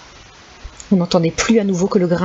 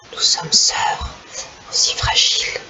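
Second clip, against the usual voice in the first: murmured; slower than usual. Acted. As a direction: murmur the riddle to himself. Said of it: "murmurait Tomek". (murmure chuchoté)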